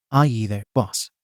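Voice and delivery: lightly voiced New York tough-guy accent; a hint of a gruff New York janitor greeting the boss. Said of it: "with a gruff New York accent".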